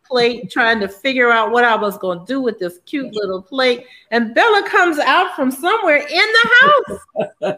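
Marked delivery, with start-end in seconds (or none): 4.1-7.6 laughing relief. Real, not acted. Dog reappears, laughing relief.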